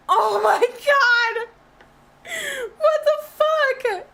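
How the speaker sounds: high, slow cry of disbelief; shocked wail. Real, not acted. Streamer watching in disbelief, shocked wail. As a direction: stretch it out high, stunned.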